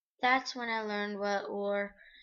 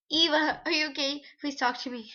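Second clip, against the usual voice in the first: worried plea; 6 semitones higher, 9 dB louder than usual. Acted. Friend finds Eva wounded.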